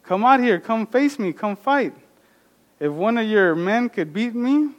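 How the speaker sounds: Goliath dares the army to come fight him; Goliath taunting. Retold or imitated. Giant taunts the Israelites, calling them out. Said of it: "taunting them like".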